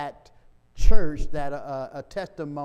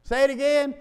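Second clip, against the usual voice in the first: asking to repeat; 8 semitones higher, 6 dB louder, faster than usual. Retold or imitated.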